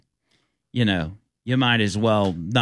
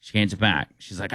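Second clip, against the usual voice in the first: normal talking voice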